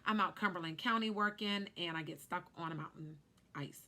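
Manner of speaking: normal talking voice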